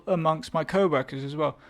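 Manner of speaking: ordinary talk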